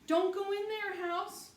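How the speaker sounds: put-on very high, very loud frightened child; little sister screaming a warning; screamed. A narrator's reading. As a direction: scream at her brother to stop. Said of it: "screamed Honey".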